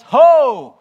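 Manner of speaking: huge, high shout of "Ho!"; prophet calling out. Acted. Prophet Isaiah summoning the thirsty, booming high shout. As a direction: bellow it out across a crowd. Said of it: "prophet Isaiah says".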